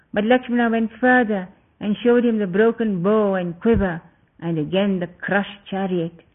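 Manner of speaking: usual speaking voice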